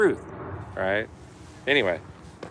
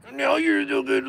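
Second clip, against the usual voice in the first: much higher prissy voice; a self-righteous officer preaching; mocking a cop; 4 dB louder than usual. Cop acting righteous, high mocking voice.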